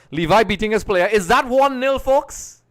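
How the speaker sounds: loud, high burst of commentary; excited goal call. Real, not acted. Live commentary as goal goes in.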